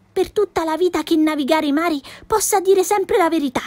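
put-on bright cheeky girl voice, lightly done; a hint of a feisty little girl protesting; lightly voiced Pippi, indignant. Pippi pleading her excuse.

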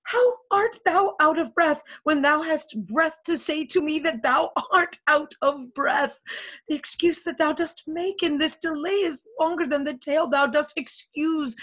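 high, insistent argument; exasperated. Acted. Juliet arguing with the nurse who withholds news, exasperated. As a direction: scold a stalling messenger in one long rush.

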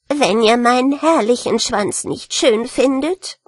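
rat unable to hold back its rage; wütende Ratte. Acted. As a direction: declare it in offended fury. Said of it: "vor Wut nicht mehr halten". (wutentbrannte Ratte)

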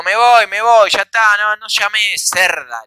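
shouted retreat ending on an insult; angry shout. Retold or imitated. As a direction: holler it at the house in exasperation. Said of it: "Grito y le digo".

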